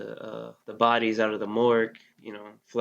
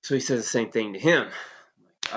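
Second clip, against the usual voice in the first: ordinary talk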